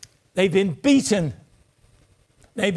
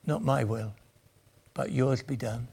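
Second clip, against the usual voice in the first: quiet surrender; 7 semitones lower, 7 dB quieter than usual. Retold or imitated. Jesus's submission in Gethsemane.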